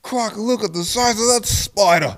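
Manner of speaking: high-pitched hammed-up Aussie shout; mock Australian. Joining a friend's spider bit, mock Australian. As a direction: exclaim like a cartoon Australian, high.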